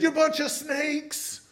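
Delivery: slow, high, thundering rebuke; John the Baptist rebuking. Retold or imitated. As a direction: draw out each word like a prophet condemning. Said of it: "And he said".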